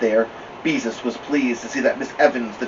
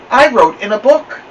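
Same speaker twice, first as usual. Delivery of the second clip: unashamed; 11 semitones higher, 9 dB louder than usual. Acted. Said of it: "not the least ashamed".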